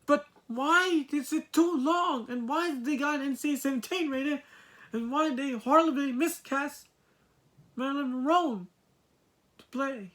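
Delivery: high, indignant string of questions; exasperated rant. Real, not acted. Reviewer listing everything wrong with the film, exasperated. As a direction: pile up complaints in a high, frustrated voice. Piling up complaints about film.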